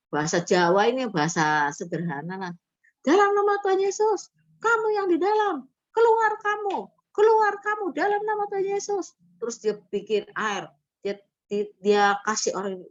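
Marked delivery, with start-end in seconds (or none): 3.1-9.1 very high, insistent casting-out cry; imitated exorcism command. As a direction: mimic a simple believer ordering a spirit out, pitched way up. Retold exorcism by simple church member.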